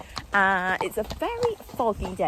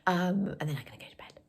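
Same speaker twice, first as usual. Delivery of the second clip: whispered, drained and soft; sleepy whisper about bedtime; tired whisper; 4 dB quieter than usual. Real, not acted. Exhausted, ready for bed.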